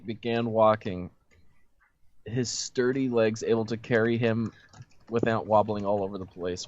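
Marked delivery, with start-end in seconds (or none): none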